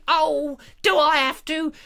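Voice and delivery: high-pitched drawn-out whine; mock reluctant Julia. Julia reluctant to get up early, high mock whine. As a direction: moan it in her comic high voice. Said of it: "she was very reluctant".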